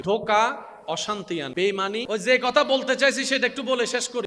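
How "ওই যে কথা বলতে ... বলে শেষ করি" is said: usual speaking voice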